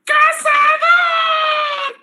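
very high, slow, stretched shout; dramatic drawn-out cry; shouted code word. Pretend. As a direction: yell the code word to jog a memory. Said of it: "lo voy a decir con una palabra clave".

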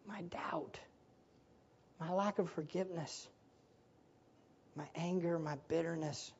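hushed, breathy prayer of surrender; whispered prayer. Acted. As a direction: pray it softly to God. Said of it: "we cry out".

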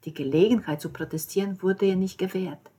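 plain reading voice

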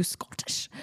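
Scottish accent on the one word; attempted Scottish accent. Shown as a demonstration. Host showing the only word she can manage, in Scottish accent. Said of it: "I could only say Scottish".

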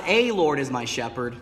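the word "a" leaned on, set against "the"; stress on a. Shown as a demonstration. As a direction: put weight on "a", as the wrong reading.

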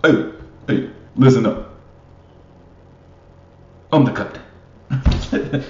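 mock locker-room captain rallying the team; bossy team captain. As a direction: bark it out like the self-appointed leader. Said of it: "Me as Tom and the Mitchell would be like".

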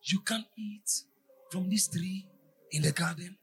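slow, insinuating question; slow sly serpent. Retold or imitated. Preacher reading the serpent's lure, slow and sly. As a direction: draw it out like the tempter. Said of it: "Look at Satan".

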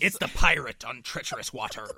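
hammed-up pirate voice. Co-host joking about treacherous waters, pirate voice. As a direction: growl it like a storybook pirate. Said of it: "He makes a good pirate".

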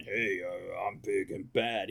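lightly voiced low tough guy; a hint of a lumbering heavy; big bad guy. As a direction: play a mock big bruiser. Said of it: "instead of being".

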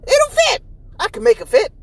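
high, loud eager insistence; insisting. Retold or imitated. To coworkers at work, a high eager insistence. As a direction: squeak out that it'll fit. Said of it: "And I'm like".

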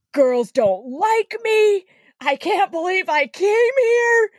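high, slow sorry wail; self-pitying whine. Retold or imitated. Drunk guy lamenting to his friend, high whiny and slow.

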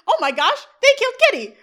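high, loud cartoonish alarm; loud parody of panic; mock horrified outcry. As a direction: cry out in exaggerated shock. Eric's horrified outcry, retold.

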